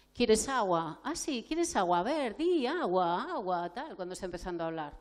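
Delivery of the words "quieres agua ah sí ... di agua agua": put-on louder, higher, slow sing-song coaxing a toddler; overeager adult coaxing a child. Shown as a demonstration. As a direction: pile on eager questions to the child. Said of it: "lo que nos sale normalmente con otro niño".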